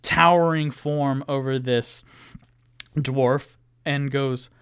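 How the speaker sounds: normal talking voice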